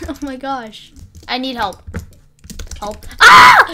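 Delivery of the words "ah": sudden high shrieking scream; sharp startled yelp, very high and loud; panicked scream. Real, not acted. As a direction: shriek as if caught off guard. Ambushed mid-fight in game.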